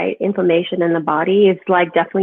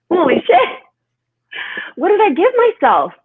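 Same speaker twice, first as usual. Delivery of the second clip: higher and louder, imitating her shocked self; retold shock; 10 semitones higher, 4 dB louder than usual. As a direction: replay the horror at the mirror.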